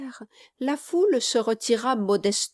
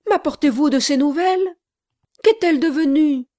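higher, louder, fast eager questions; anxious pleading; 7 semitones higher, 7 dB louder, faster than usual. Acted. Bride begging the gentleman for news, anxious and fast. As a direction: beg for news of her.

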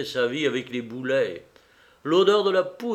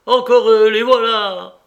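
loud, high cry of exasperation; exasperated captain's shout; angry alarm; 9 semitones higher, 11 dB louder than usual. A narrator's reading. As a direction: call out the enemy sighting. Captain spots the English again.

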